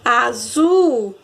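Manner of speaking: "azul" with a long, swelling ZUL; calling the word. Shown as a demonstration. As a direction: call out "azul", stretching the last syllable. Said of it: "como se eu estivesse chamando a palavra".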